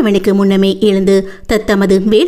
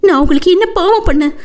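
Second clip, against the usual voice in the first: higher, fast, frightened pleading; a scared young woman begging him to stop; trembling with fear; 7 semitones higher, faster than usual. A narrator's reading. Terrified woman questioning her tormentor, higher and trembling. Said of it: "நடுங்கும் உடலோடு".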